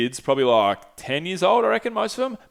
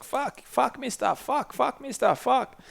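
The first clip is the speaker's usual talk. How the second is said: put-on very high child voice, cheeky; imitating kids swearing; 12 semitones higher, 5 dB quieter, slower than usual. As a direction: pitch right up like little kids showing off a swear word.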